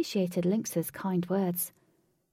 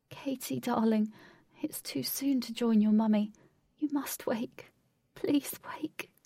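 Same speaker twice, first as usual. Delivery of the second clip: tearful pleading; 4 semitones higher than usual. Acted. Father begs his daughter to wake, pleading. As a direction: beg gently, voice raised in pitch.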